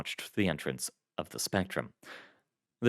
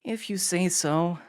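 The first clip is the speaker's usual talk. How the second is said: high, slow doubtful reply; unconvinced, drawn out; dubious; 10 semitones higher, slower than usual. A narrator's reading. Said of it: "Iris said dubiously".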